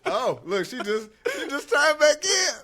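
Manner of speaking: laughing while joking; laughing joke. Real, not acted. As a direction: crack up at the thought. Joking about wife voice.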